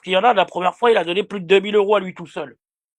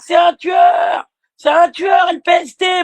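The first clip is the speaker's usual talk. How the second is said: much higher, slow mock shout; mock hysterical yelling; 8 semitones higher, 3 dB louder, slower than usual. Streamer imitating her screaming like a hysteric, high mock shout. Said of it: "crier comme une hystérique".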